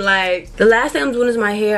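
normal talking voice

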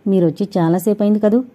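usual speaking voice

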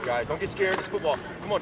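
ordinary talk, nothing marked

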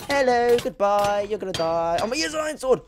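high, loud gleeful yelling; excited battle shout. Real, not acted. Gamer attacking a player for his sword, yelling. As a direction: shout it high as you pounce.

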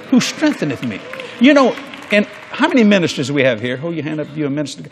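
ordinary talk

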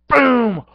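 loud punchy cartoon boom; boom effect. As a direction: slam the sound out. Hit sound effect.

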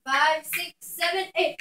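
normal talking voice

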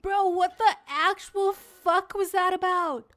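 high-pitched stunned questioning; shaken disbelief. Real, not acted. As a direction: ask it high, still reeling from the scare. Shaken after jump scare.

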